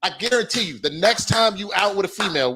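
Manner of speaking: ordinary talk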